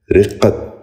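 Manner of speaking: رقت said "riqqat", short "i" and doubled q; correct pronunciation. Shown as a demonstration. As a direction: open رقت with "ri" and hold the q. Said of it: "دوبارہ سنیے".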